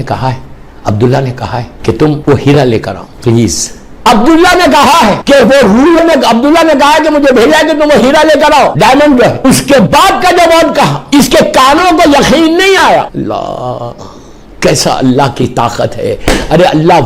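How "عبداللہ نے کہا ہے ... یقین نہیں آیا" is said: fervent sermon peak; rising fervour. Real, not acted. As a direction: drive the climax up with force. Preacher builds to climax.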